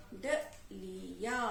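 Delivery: डलिया low and slow, "da... lii... yaa"; syllable by syllable. Shown as a demonstration. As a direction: separate each syllable of डलिया with a gap. Teacher sounds out word by syllable.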